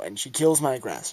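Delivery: usual speaking voice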